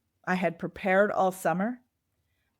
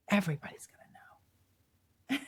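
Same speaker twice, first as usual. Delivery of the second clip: slow, hushed, worried whisper; whispered fear of being found out; fearful whisper; 6 dB quieter than usual. Retold or imitated. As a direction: breathe it out like a dreaded secret. Voicing her fear of failing.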